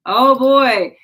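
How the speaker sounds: loud exclamation through a yawn; tired, yawn-filled outburst. Real, not acted. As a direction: let a real yawn carry the words. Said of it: "sorry, I'm making everybody yawn".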